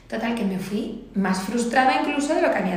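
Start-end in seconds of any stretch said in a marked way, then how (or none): none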